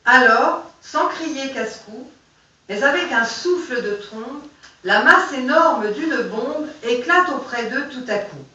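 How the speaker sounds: dramatic reading of a poem. Acted. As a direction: carry the verses through to the ending. Reads poem aloud.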